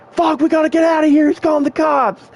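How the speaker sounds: very high, mock-panicked friends; retold panic. Retold friends panicking about police.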